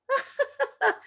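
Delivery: high, mock-dainty giggle; polite feminine laugh. Women laughing in company, polite feminine laugh. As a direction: imitate a high ladylike titter. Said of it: "we don't go".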